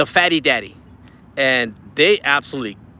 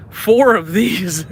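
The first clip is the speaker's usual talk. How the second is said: stunned; 3 semitones higher, 4 dB louder, much slower than usual. Real, not acted. Amazed repeat of gift count.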